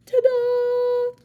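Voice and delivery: bright game-show reveal; triumphant ta-da. Acted. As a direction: sing it out like a fanfare. Triumphant flourish after catchphrase.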